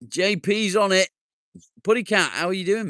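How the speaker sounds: high, loud, fast cheery hello; excited greeting. Real, not acted. Streamer greeting a regular viewer, high excited welcome.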